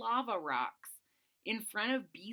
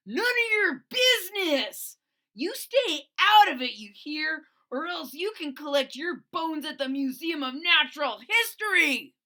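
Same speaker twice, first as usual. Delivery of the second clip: loud, higher snarling threat; menacing threat; 7 semitones higher, 8 dB louder than usual. Acted. Tyrone threatening Boland to stay out of it, loud. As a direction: warn him off like a bully.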